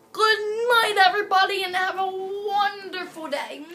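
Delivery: loud, high, excited farewell; big sign-off. Real, not acted. Child ends the toy story for everyone, loud excited goodbye. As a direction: shout goodnight to the audience. Ending the show loudly.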